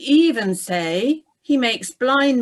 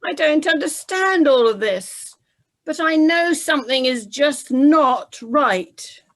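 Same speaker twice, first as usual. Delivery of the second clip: louder, higher anguished soldier voice; shaken soldier; 5 semitones higher, 6 dB louder than usual. Acted. Soldier cries out after Jesus dies, louder and higher. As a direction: protest at everything you've seen.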